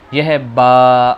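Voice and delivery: slow, stretched letter name; drawn out. Shown as a demonstration. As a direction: drag out با. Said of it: "اسے تھوڑا کھینچ کر پڑھیں گے".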